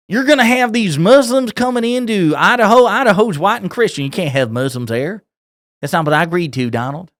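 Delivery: mock outrage in a rural Kentucky twang; hammed-up MAGA accent. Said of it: "my default accent for maga".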